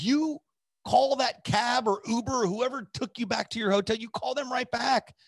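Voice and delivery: high, insistent command; bossy retold order. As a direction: imitate her ordering him straight back. Friend orders him back, retold.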